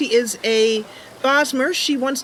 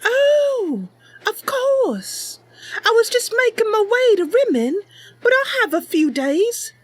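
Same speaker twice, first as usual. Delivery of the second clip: put-on much higher, eager young Bosmer girl; Mercy's voice; 13 semitones higher than usual. Mercy telling her cat Sasha about the stranger, high eager voice. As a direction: lift way up into a sweet, earnest young knight-hopeful.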